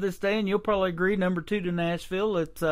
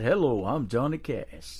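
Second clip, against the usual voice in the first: deep, slow baritone impression; 8 semitones lower, slower than usual. Host imitating a country legend's introduction, deep baritone. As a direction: drop low and draw out the greeting. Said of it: "that deep baritone voice he had".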